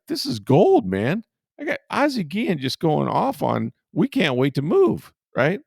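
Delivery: bursting with glee over a story; mock-giddy reporter; thrilled. Reporter thrilled at his scoop.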